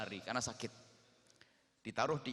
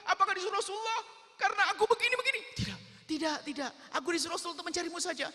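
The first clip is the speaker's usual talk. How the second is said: put-on high, loud, panicked child's voice; terrified boy; 10 dB louder than usual. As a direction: plead in panic, afraid of judgement. Terrified boy pleads, Umar reassures.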